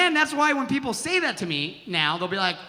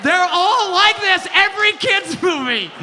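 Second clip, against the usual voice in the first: shouting the bit; 7 semitones higher, 5 dB louder than usual. Acted. Comic shouting the punchline to the audience, loud. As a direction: belt it to the crowd.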